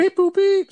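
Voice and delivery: high robotic beep boop; computer processing. Pretend. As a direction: mimic a computer thinking. Pretends to compute a math answer.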